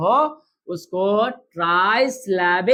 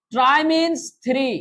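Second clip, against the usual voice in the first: very slow, drawn-out statement; slow teacher statement; slow teaching; 3 semitones higher, much slower than usual. Shown as a demonstration. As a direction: spell out the meaning slowly for the class. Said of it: "teacher states the meaning slowly".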